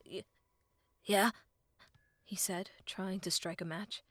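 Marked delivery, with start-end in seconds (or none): 0.0-1.3 shivering stutter; Link. A narrator's reading. Shivering boy answering the fairy, stuttering. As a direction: answer haltingly from the cold. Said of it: "Link shivered uncontrollably".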